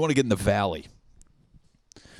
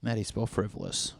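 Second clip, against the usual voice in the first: usual speaking voice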